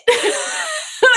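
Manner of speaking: bursts into a high, loud laugh; can't hold the giggle in; real amused cackle. Real, not acted. Laughing at the quote.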